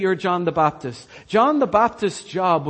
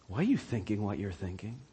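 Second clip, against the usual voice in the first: near whisper; 8 semitones lower, 11 dB quieter than usual. Acted. Preacher to his congregation, as Jesus, in a searching near whisper. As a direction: lean in and ask it almost under the breath.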